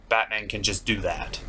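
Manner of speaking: usual speaking voice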